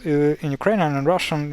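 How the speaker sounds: normal talking voice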